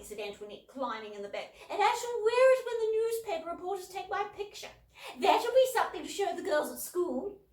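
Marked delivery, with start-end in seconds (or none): 1.7-7.4 put-on high, loud posh girl dreaming aloud; dreamy show-off. A narrator's reading. Elder sister dreaming of a yellow dress, high and gushing. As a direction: gush about the dress like a diva.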